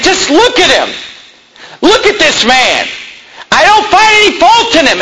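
high, straining plea as Pilate; Pilate pleading. Retold or imitated. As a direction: show the crowd the beaten man, begging them to see. Pilate presenting beaten Jesus.

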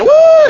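high, loud mock party "woo"; a whooped imitation of TV revelers. Preacher mimicking bikini party on TV, party whoop.

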